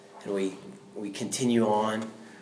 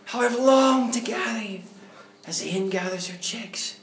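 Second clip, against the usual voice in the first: voice of the Lord; 12 semitones higher than usual. Retold or imitated. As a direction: speak as God, aching with longing. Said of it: "the Lord is saying, like thunder".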